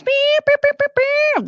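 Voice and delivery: high sung beep beep beeps; mock alarm beeps; mimicked alert beeps. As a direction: imitate a donation alert chime, high and bright. Super chat alert imitation.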